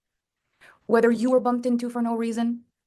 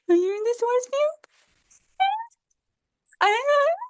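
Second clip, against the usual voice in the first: high-pitched, mocking taunt; teasing pest; 12 semitones higher than usual. People testing someone in source field, teasing. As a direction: needle them in a silly high voice.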